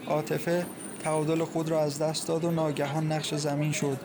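usual speaking voice

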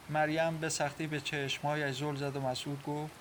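ordinary delivery, nothing marked

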